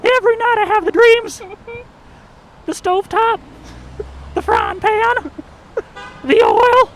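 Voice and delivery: high, loud panicked corn voice; a corn cob begging for its life; terrified talking corn. Pretend. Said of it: "Ear of Corn that can now speak and is terrified of being popped".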